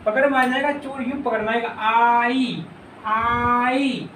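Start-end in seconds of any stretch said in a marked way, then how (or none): 1.8-4.1 आई stretched long on the opening आ; teacher sounding out आई very slowly; drawn-out pronunciation. Shown as a demonstration. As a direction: hold the आ of आई, gliding into ई. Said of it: "आई बोलते हैं ना".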